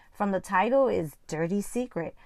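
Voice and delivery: usual speaking voice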